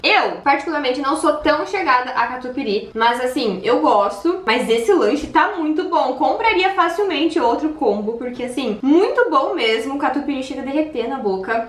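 fingers touching lips; eating a burger; talking while chewing. Real, not acted. Seen 2: holds burger, fingers at lips, chewing between lines.

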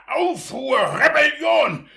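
high, loud indignant cry; outraged outburst. Acted. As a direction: explode at the scandal.

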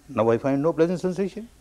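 ordinary talk, nothing marked

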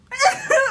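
loud shriek of laughter; high shrieking laugh. Real, not acted. Vlogger shrieking with laughter over her Popeyes craving.

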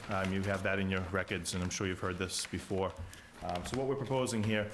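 normal talking voice

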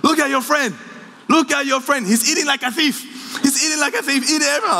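dad telling off the friend, comic high voice; imitating dad scolding. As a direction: scold the hungry friend, hammed-up.

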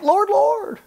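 high, loud mock-pious cry; hammed-up fervent invocation; emphatic. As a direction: imitate an overzealous believer calling out. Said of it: "They're emphatic".

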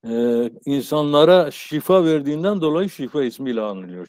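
usual speaking voice

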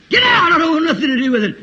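very high yell, then rushed dismissal; acted angry outburst; furious refusal. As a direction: explode at the visitor.

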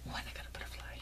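whispering in wonder; awed whisper. Real, not acted. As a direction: breathe it reverently. Whispering over gifts.

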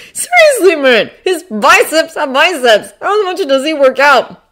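high, loud, insistent enthusiasm; high-pitched fanboy disbelief; amazed gushing. A narrator's reading. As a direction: marvel loudly like an excited boy. Ned gushing about biceps.

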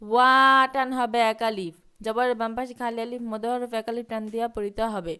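slow, drawn-out recitation of the verse; slow Quran recitation. Acted. Teacher recites the verse slowly for pupils to repeat. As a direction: chant it slowly for the class to follow.

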